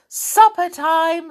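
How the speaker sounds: loud, slow call to supper; shouting. Acted. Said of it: "shouted Moonface suddenly".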